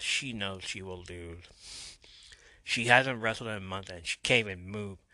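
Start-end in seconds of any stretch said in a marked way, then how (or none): none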